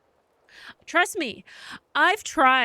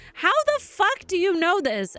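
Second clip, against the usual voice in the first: higher, incredulous retold outburst; disbelief; 9 semitones higher than usual. To her boyfriend, stunned he knows how islands are made. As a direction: act floored by what he knows.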